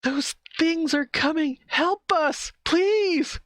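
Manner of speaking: very high, frantic plea for help; pleading woman's voice. Acted. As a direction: beg like a terrified woman. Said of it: "it's a woman's voice right now".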